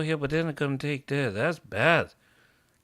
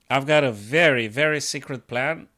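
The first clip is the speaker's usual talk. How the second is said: normal talking voice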